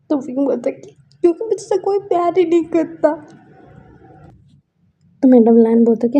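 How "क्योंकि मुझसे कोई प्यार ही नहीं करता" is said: high, crying answer; tearful reply. Acted. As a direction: answer through tears. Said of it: "क्यों रो रहा है तू". (रोती हुई ऊँची आवाज़)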